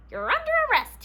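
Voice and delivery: high, loud official announcement; stern officer. Acted. Sergeant Hefty arresting the fox, loud high announcement. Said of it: "he announced".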